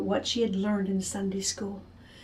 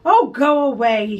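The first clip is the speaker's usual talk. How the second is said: annoyed; 8 dB louder, slower than usual. Acted. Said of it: "she said".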